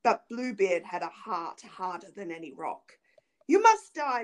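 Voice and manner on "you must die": louder, higher, forceful pronouncement; stern death sentence. A narrator's reading. Bluebeard sentences his pleading wife, stern pronouncement. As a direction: condemn with no mercy.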